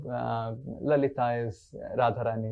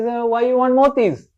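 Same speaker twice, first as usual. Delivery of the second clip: high, loud, puzzled question; Mother Yasoda; 11 semitones higher, 10 dB louder than usual. Acted. Mother questions Krishna's wish for motis, baffled. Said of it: "Mother Yasoda says".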